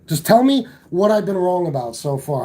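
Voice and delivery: normal talking voice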